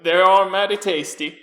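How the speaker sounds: put-on loud, abrupt old husband voice; startled sleepy husband. Husband hit awake by his wife, hastily agreeing. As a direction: blurt it as if just jolted awake. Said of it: "snaps awake and goes".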